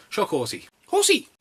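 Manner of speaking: builds to a high squealed horsey. Acted. Reviewer mocking a film title, ending in a high loud squeal. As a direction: crank it up until horsey squeaks out.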